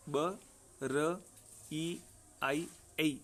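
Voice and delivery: ordinary talk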